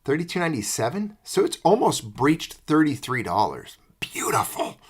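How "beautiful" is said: breathy whispered delight; whispering. Real, not acted. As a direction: whisper it in awe. Savoring the silver price.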